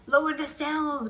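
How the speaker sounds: high, loud storm order; a captain's hearty order; shouted. A narrator's reading. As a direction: call it over the gale. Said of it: "Braided Beard shouted".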